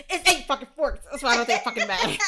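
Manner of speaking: laughing through a mock rant; cracking up while quoting. Retelling Derek's outburst, laughing.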